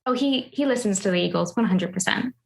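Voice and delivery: ordinary delivery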